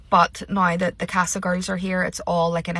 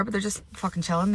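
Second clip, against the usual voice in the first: normal talking voice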